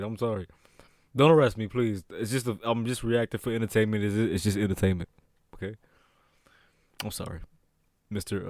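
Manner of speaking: low, hushed pleading; small, quiet and sheepish; meek apology. Real, not acted. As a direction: plead softly, almost under your breath. Meek plea to officer on screen.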